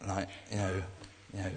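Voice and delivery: ordinary talk, nothing marked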